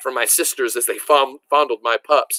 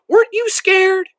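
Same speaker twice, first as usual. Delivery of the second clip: put-on a much higher, louder girl's voice; little sister; 5 dB louder than usual. A narrator's reading. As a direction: squeak it like an excited little girl. Little sister asks, voiced high.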